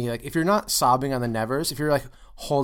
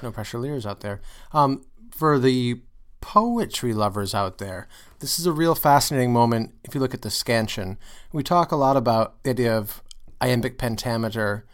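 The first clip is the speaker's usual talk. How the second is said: ordinary delivery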